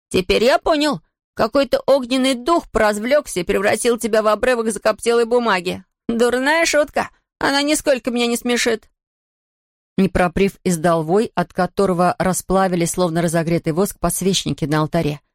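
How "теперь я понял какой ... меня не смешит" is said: high mocking taunt. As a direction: sneer at the ghost, pitched up. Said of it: "продолжил он".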